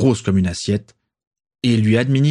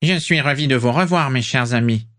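higher, a light unpleasant nasal voice; voix nasillarde désagréable; 5 semitones higher than usual. A narrator's reading. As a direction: greet them in a faintly nasal, unpleasant tone. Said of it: "la voix nasillarde et désagréable". (le petit homme salue ses complices d'une voix nasillarde)

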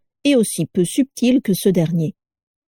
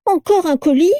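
much higher whiny moan; whining; 11 semitones higher, 3 dB louder than usual. A narrator's reading. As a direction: groan it in exasperation. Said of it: "gémit Carla".

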